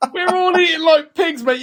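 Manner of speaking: high, laughing shout; cracking up while confessing; shrieking with real laughter; laughing hard. Real, not acted.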